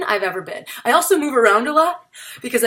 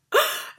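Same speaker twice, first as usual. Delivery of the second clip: mock horrified gasp. Mock horror at own hands.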